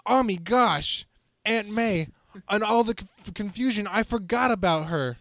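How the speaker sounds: jolted alarm as he remembers his aunt; sudden alarm. Acted. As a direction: remember something terrible all at once. Sudden realization about Aunt May.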